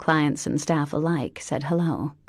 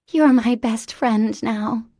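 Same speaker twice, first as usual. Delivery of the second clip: put-on high, bright-eyed childlike voice; an eager little girl in a grown woman; childlike Mary Elizabeth; 6 semitones higher, 7 dB louder, slower than usual.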